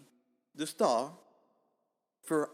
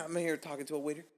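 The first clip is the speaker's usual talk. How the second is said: ordinary talk, nothing marked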